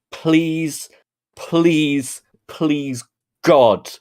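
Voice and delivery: drawn-out, exasperated pleading; begging. Real, not acted. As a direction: plead slowly, spacing out each please. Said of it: "I'm fucking begging you".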